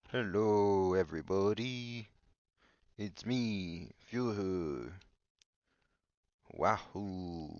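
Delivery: drawn-out mock German accent; bad German accent. Game villain greeting everybody, bad German accent. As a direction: attempt a German accent, stretching the words. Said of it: "he's in a German accent, and he goes".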